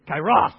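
kairos, second syllable blasted out loud; stress with gusto. Shown as a demonstration. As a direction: throw your weight on the end of kairos. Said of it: "gotta say it with some gusto".

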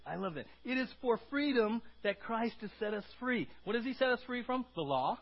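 ordinary talk, nothing marked

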